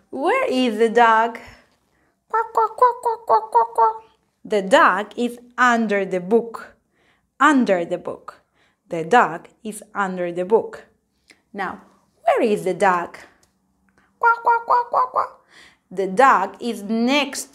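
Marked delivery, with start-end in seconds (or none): 2.3-4.0 high quacking duck voice; squeaky puppet duck quacking. Pretend. Teacher voicing a toy duck for the class, high quacks. 14.2-15.3 high quacking duck voice; squeaky puppet duck quacking. Pretend. Teacher voicing a toy duck for the class, high quacks.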